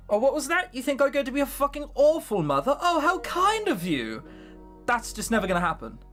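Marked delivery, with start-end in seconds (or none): 0.1-4.2 sarcastic mock voice. Mock sarcastic quote of Rosa.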